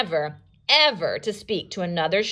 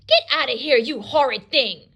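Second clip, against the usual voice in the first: upset granny; 5 semitones higher, 3 dB louder than usual. Acted. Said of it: "She's upset that he interrupted".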